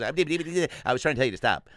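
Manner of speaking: stutter, then blurt out the sentence; stuttering Porky Pig. Acted. Cartoon pig finally finishing his late warning, exasperated stutter. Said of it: "He goes".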